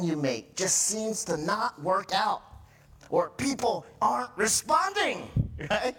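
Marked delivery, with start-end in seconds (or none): 3.1-5.3 exasperated. Acted. Acting out frustration at people.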